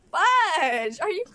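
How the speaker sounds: loud, drawn-out burst of frustration; frustrated shout. Real, not acted. Vlogger venting about her critical relatives, shouting in frustration. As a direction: belt out FUDGE in exasperation. Venting, repeats the curse loudly.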